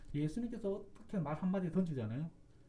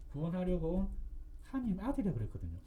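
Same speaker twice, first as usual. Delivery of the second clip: ordinary talk, nothing marked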